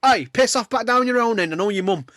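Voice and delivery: put-on high, loud grumpy old man yelling at kids; mock shout. Old man chasing kids from his house, mock yell. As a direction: shoo the kids away like a cranky neighbour.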